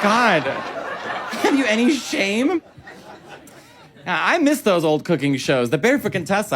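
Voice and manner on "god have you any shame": very slow, higher mock British disdain; appalled Brit. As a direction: stretch it out in prim British horror. British judge reacting.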